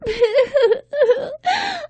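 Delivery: high, loud acted wail; loud wailing sob. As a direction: bawl like a child. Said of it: "began to cry very loudly".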